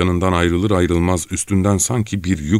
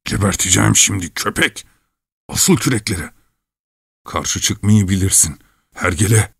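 irritated growling, slightly raised pitch; angry grumble; 4 semitones higher than usual. Acted. Çelkaş cursing his rower Gavrila, angry grumbling. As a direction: grumble crossly at an underling. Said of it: "kızgın kızgın homurdanıyordu".

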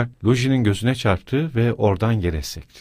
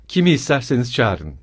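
plain reading voice

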